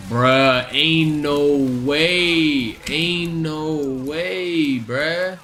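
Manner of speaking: drawn-out, slow disbelief. Real, not acted. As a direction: stretch each word slowly. Disbelief at enemy health.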